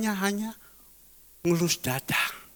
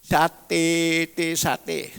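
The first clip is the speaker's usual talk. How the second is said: mock sate hawker; 3 semitones lower, much slower than usual. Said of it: "jadi marketingnya sate".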